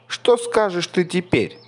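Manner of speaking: high, loud smug question; smug mouse. A narrator's reading. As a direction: rub it in to the monster. Mouse asks smugly.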